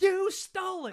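high, loud shouted accusation; mock outraged accusation. Traveller accuses companion of taking his card, mock outrage. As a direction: yell it as a hammed-up outburst.

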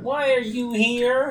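high-pitched game creature voice; put-on high, whining fish; fish character voice. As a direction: imitate an exasperated fish creature. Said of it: "he sounds like that one fish unit".

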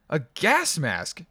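loud, slow urgent exclamation; exclaiming. A narrator's reading. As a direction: blurt it out in alarm. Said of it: "I exclaimed".

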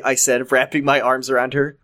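ordinary delivery, nothing marked

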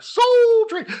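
high, loud thrilled shout; excited exclamation. Retold or imitated. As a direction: cry the name out in delight. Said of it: "I was like".

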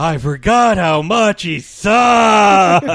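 real frustrated yell; exasperated shout. Real, not acted. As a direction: wail it in disgust. Ranting about Gabra.

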